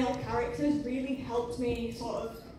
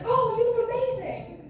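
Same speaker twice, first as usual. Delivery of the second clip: put-on high, louder gushing old lady; 9 semitones higher, 5 dB louder than usual. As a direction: imitate an old lady cooing with admiration. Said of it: "this old lady with her grandson".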